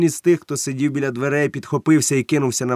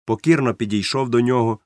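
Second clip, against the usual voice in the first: plain reading voice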